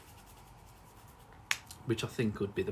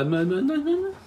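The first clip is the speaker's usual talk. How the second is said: high, loud gibbering into laughter; starstruck; 8 dB louder, much slower than usual. Acted. Fan asking for a photo, starstruck. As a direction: gibber helplessly, pitch climbing into a squeal. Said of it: "Asking for a photo".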